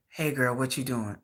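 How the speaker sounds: low, soft sly man's voice; low mock suitor. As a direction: drop low and flirt. Plays the man calling.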